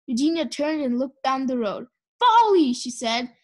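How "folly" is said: high, loud exclamation; indignant cry. A narrator's reading. Eugenia looks down the road for Baby, indignant cry. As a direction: cry it out in outrage.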